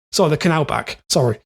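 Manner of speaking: ordinary talk, nothing marked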